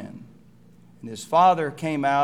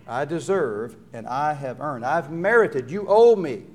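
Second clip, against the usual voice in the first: self-righteous attitude; 2 semitones higher, 6 dB louder than usual. Retold or imitated. Preacher voicing the older son's self-righteous claim, building louder. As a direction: swell with entitlement to the end. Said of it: "That's his attitude".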